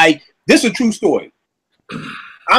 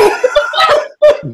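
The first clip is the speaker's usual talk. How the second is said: very high cackling laugh. Real, not acted. Mocking reformed Twitter guys to friends, laughing. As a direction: burst out laughing, high and helpless. Laughs at greasy men's sudden conversion.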